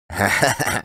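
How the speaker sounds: short knowing chuckle; sly thug's snigger. Acted. Gang watching Klin and Varvara from bushes, sniggering.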